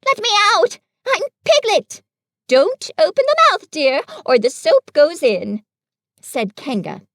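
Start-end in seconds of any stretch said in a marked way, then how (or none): 0.1-2.0 much higher, louder pleading; crying out. A narrator's reading. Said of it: "cried Piglet". 2.5-5.6 higher, kindly mother kangaroo; lightly voiced motherly Kanga. A narrator's reading. Kanga speaking in the story.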